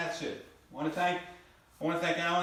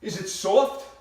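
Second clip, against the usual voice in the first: calling out; 3 semitones higher, 7 dB louder, much slower than usual. Retold or imitated. Wife calling across house.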